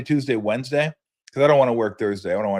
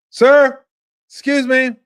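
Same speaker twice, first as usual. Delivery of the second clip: high, loud polite call after someone walking away; imitating a gate agent chasing a passenger; flight attendant calling; 14 semitones higher, 10 dB louder, slower than usual. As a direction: call out sweetly but urgently, pitched up.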